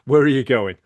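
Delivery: a loud nosy question; loud demo question. Shown as a demonstration. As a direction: fire off "Where are you going" eagerly. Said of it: "so glad you got that right".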